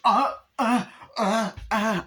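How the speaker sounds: high, loud strangled moans; repeated high choking grunts, hammed-up; mock choking moans. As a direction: imitate being choked, sounding like sex. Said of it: "sounds like she's having sex".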